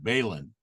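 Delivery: "Balin" said BAY-lin, with a long A; "Balin" as BAY-lin, tentatively quoted; quoted mispronunciation. Retold or imitated. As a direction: say "Balin" with first syllable like bay. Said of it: "he, he pronounced it".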